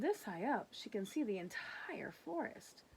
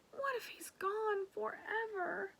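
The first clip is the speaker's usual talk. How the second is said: scared whisper; 12 semitones higher than usual. Acted. Girl fears losing dog.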